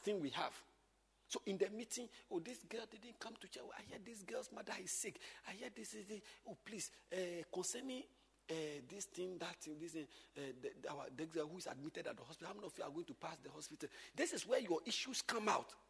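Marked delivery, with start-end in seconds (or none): 2.3-13.9 soft voiceless whispered gossip; gossipy whisper. Acted. Teacher whispering gossip about a sick girl. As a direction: murmur it like a nosy teacher.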